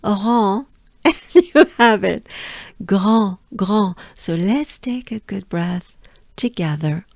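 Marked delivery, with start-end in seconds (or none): 2.8-3.9 "Grand" with a uvular R and nasal vowel; grand à la française; French grand. Shown as a demonstration. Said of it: "mix it a R and a on".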